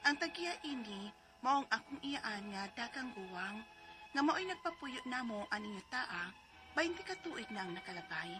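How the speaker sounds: ordinary talk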